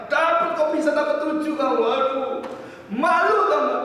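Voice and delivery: high, loud scolding shout; angry father. Retold or imitated. Professor father scolding his son over grades, angry shouting. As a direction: berate the child at full volume. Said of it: "dia marah-marah pada anaknya".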